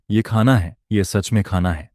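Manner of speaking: ordinary delivery, nothing marked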